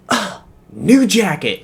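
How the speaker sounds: exasperated, high and drawn-out whine; mock a classmate upset over her clothes; upset classmate impression. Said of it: "literally the words out of her mouth exactly".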